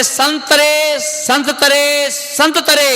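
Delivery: "संत तरे" stretched slowly and high, तरे falling; slow split call. Shown as a demonstration. Preacher reveals the pun: slow high "संत तरे". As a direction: draw out "संत तरे" as two slow words. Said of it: "बोले".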